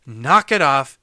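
loud, firm mock reprimand; retold stern telling-off. Student confronting his drunk friend, loud retold scolding.

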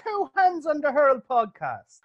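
very high, slow cartoon voice for the show name; squeaky put-on voice. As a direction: stretch it out in a tiny squeaky voice. Podcast tagline chant repeat.

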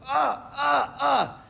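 high, loud grunts of exertion; imitated straining porter; effortful groans. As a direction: groan with each heavy push. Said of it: "every push from the wagon, he's like".

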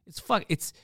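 ordinary talk, nothing marked

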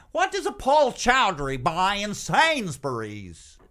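high, slow teasing question; mock interviewer. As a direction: ask it like a nosy reporter.

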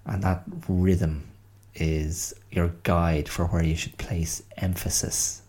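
normal talking voice

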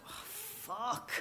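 hushed, breathy curse under the breath; dismayed whisper. Retold or imitated. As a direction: whisper it as your heart sinks. Dismayed aside on seeing her date.

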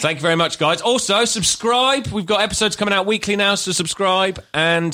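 high-pitched presenter patter; podcast outro plug. Acted. Host urging listeners to subscribe, high-pitched presenter voice.

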